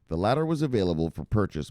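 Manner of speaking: measured, unhurried read-aloud; slowed down a little. Real, not acted. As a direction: read it again at an easier pace. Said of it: "let me slow down a little".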